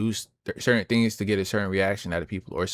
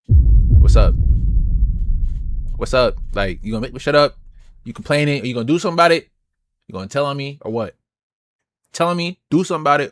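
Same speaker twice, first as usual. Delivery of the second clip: cocky, raised-voice challenge; acted confrontation; 3 semitones higher, 7 dB louder than usual. Seated fan squaring up to a heckler, challenging. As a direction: push each question at him like a dare.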